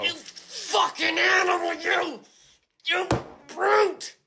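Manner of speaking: high, loud, slow shouted insults; outraged insult. Acted. To the friend who ruined his cards, shouted outraged insult. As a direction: roar at him like he's a savage.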